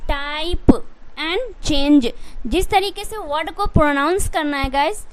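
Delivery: normal talking voice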